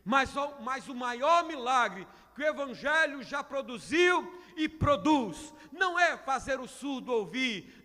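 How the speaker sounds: normal talking voice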